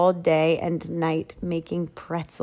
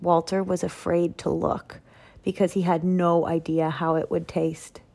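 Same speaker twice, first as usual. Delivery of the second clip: plain reading voice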